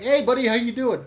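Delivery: loud, high-pitched, energetic greeting; imitated high energy. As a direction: imitate a customer bursting in full of energy. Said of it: "He's, like, all full of energy".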